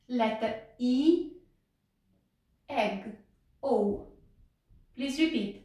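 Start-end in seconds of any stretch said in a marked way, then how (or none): 0.8-1.3 E as a slow, held ee; long sound. Shown as a demonstration. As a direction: stretch out the vowel in E. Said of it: "Sunet lung și pronunț". (E cu sunet lung, rostit rar)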